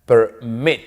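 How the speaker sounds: "Permit" split, MIT hit harder; stress on the final syllable. Shown as a demonstration. English teacher showing students where the stress falls, final-syllable stress. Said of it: "la mayor fuerza de voz está al final".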